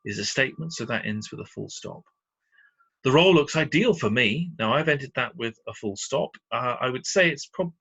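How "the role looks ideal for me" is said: positive example. Shown as a demonstration. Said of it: "it is a very positive".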